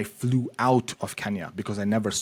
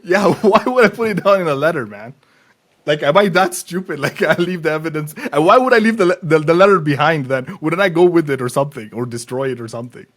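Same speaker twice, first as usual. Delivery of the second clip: loud, higher-pitched real indignation; incredulous protest; 5 semitones higher, 9 dB louder than usual. Real, not acted. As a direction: protest loudly at the sheer absurdity. Indignant reply to absurd accusation.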